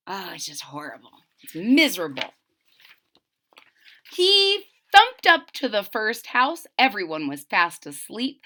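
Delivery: usual speaking voice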